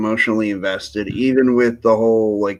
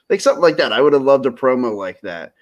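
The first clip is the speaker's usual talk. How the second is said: ordinary talk, nothing marked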